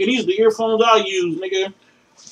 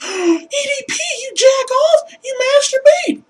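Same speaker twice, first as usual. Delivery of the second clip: gasping, much higher put-on girly voice; mock shocked falsetto; slower than usual. Mocking a shocked woman who just found out he masturbates, gasping in falsetto. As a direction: play a scandalized woman catching him.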